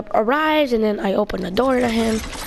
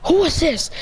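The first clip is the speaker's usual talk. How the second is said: startled question; 6 semitones higher than usual. Retold or imitated. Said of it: "And I'm like".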